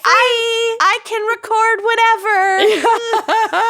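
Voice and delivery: high, slow imitation of Lucy; high imitating voice. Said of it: "Lucy's like".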